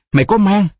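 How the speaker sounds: high-pitched horrified question; shocked, wailing question; aghast cry. A narrator's reading. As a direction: let the voice leap up in disbelief. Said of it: "thất thanh hỏi".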